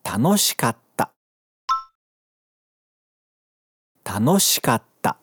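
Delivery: "Tanoshikatta" slowly, with a silent gap between "ka" and "ta"; slow, geminate pause. Shown as a demonstration. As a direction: hold the stop before "tta" in "Tanoshikatta". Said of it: "Repete comigo". ("Tanoshikatta" devagar, com vazio entre ka e ta)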